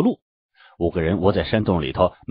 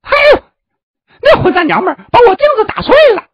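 very high, loud, fast outraged wail; anguished shriek; 11 dB louder, faster than usual. Acted. Disciple finds his mirror broken, anguished shrieking. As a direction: scream out the loss in horror. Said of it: "他突然惨叫起来".

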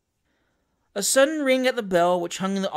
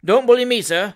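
loud, higher-pitched indignation; put-on passion; 4 semitones higher, 7 dB louder than usual. A narrator's reading. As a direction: work yourself into a temper on purpose. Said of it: "getting into a passion on purpose".

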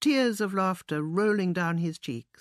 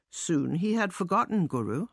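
usual speaking voice

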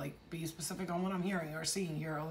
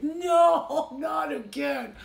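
loud, high mock wail; drawn-out, raised-pitch comic plea; despairing toilet; 8 semitones higher, 11 dB louder, much slower than usual. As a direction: cry out in hammed-up dread. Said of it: "your toilet is like".

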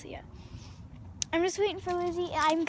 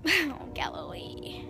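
whisper; slower than usual. Real, not acted. Talking softly to cat at window.

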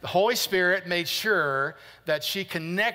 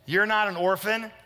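normal talking voice

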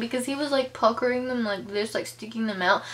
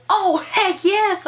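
high, loud, drawn-out heck yes; eager yes; 9 semitones higher, 6 dB louder, slower than usual. Pretend. Imagining a fan accepting the tour, higher and louder. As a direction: gush it like a thrilled fan.

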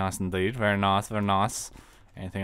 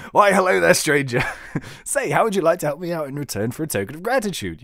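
higher, louder jovial mayor voice; hammy mayor; 6 semitones higher, 8 dB louder than usual. Acted. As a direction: greet the stranger like a smarmy mayor.

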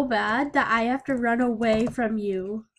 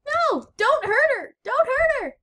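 hammed-up pleading; 12 semitones higher, 4 dB louder than usual. Pleading for the character.

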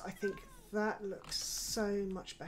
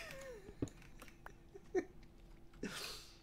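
laughing; 8 dB quieter than usual. Real, not acted. Laughs after swearing at mistake.

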